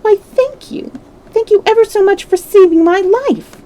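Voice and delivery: very high, tiny mouse voice; squeaky little voice. A narrator's reading. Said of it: "it said in a squeaky little voice".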